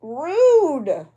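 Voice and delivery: a loud, sharp "rude!"; mock outrage. Crafter scolding her vanished knife, mock indignant. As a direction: cry it out in playful offense.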